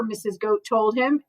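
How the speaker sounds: plain reading voice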